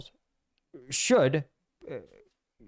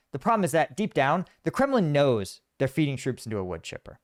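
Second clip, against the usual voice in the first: normal talking voice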